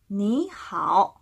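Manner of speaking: slow model "니 하오", rising 니, then dipping-rising 하오; tone-change rule applied. Shown as a demonstration. Teacher models for repetition, slow second-then-third tone. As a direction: glide up on 니, dip and lift 하오, unhurried. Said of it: "니 이 성으로 발음하시고 뒤에는 하오만".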